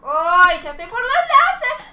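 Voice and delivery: loud, high cry climbing in pitch; real gamer yelling; excited shout. Real, not acted. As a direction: shout it out mid-game, very high. Excited cry on reaching lasers.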